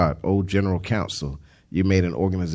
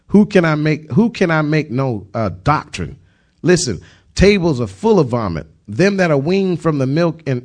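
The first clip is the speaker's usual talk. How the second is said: raised, higher-pitched sermon delivery; fervent preaching; 4 semitones higher, 5 dB louder than usual. Real, not acted. Preacher expounding the true rest to listeners, fervent preaching. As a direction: drive the scripture home with emphatic force. Preaches scripture at full force.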